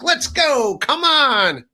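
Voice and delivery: high-pitched imitated callout; mock challenge. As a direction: mimic someone goading a rival outside. Mimics someone challenging at a house.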